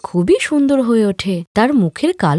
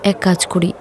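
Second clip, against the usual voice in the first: ordinary delivery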